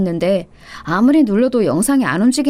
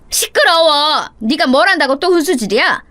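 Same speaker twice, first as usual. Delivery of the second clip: angry snap; 5 semitones higher than usual. A narrator's reading. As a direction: lash out at the daughter-in-law in a temper. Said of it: "제가 홧김에 탁 쏘아붙이자".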